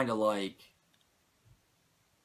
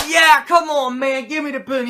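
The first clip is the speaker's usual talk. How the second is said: eager plea; 10 semitones higher, 9 dB louder than usual. Retold or imitated. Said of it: "And he's like".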